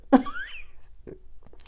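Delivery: very high, squealing laugh; real high-pitched giggling fit. Real, not acted. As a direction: crack up helplessly at your own joke. Cracks up at her own joke.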